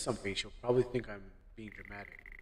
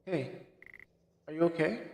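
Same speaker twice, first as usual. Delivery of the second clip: put-on girlfriend voice; 6 semitones higher than usual.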